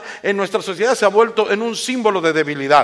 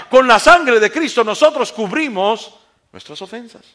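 loud, raised pulpit delivery; forceful preaching; 5 semitones higher, 8 dB louder than usual. Real, not acted.

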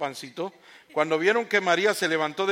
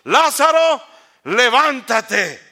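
much higher, louder call of "Lázaro", then a slow "levántate"; loud cry; 8 semitones higher, 12 dB louder, much slower than usual. A narrator's reading. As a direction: call the dead man out of the tomb. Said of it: "gritó con fuerte voz".

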